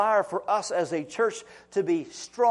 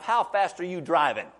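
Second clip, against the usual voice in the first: ordinary talk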